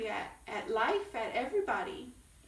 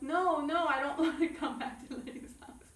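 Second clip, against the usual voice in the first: tearful pleading; 4 semitones higher, 4 dB louder than usual. Retold or imitated. Pleading with husband, crying.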